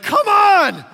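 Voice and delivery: very high, loud cry of frustration; exasperated shout. Retold or imitated. Pastor reliving his sister's spoiler text, shouting. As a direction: yell at the spoiled ending. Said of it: "I was like".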